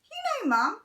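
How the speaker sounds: fixed take with Henry's quote high; sweet Henry voice. Shown as a demonstration. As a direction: switch to a high sugary Henry. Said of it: "I've done that wrong".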